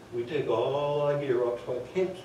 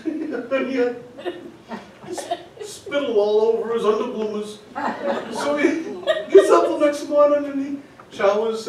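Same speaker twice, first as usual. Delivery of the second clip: higher voice, breaking into laughter; can barely get the words out for laughing; laughing while telling; 9 semitones higher, 6 dB louder than usual. Real, not acted. Teller cracking up at his own story, laughing through words.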